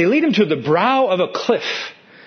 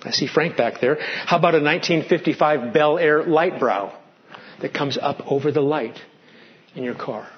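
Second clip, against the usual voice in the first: usual speaking voice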